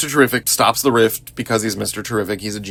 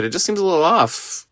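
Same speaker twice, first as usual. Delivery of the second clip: doubtful Clark, higher; 8 semitones higher than usual. Retold or imitated. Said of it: "He said".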